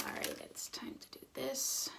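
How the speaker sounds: soft whisper, slow on the last words. Real, not acted. To viewers, whispering before starting the eye look. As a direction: hush it like a secret start. Quiet moment before a makeup step.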